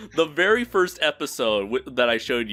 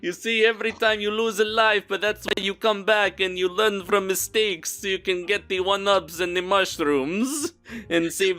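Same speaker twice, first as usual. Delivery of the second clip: higher, hammy Italian wisdom; bad Italian accent; 6 semitones higher than usual. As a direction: lecture like a cartoon Italian. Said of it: "bad Italian accents".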